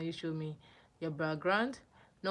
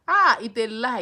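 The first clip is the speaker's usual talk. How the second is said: loud outburst, calling it a lie; sudden loud objection; loud protest; 5 semitones higher, 14 dB louder than usual. Real, not acted. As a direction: shout down the claim. Arguing with viewer on stream.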